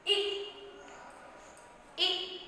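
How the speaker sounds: इक with a brief, quick i; short i. Shown as a demonstration. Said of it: "इसका sound भी कैसे होता है".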